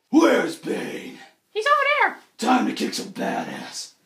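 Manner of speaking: low, gruff Batman; mock Batman voice; Batman growl. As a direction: growl like the hero. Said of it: "Batman is like".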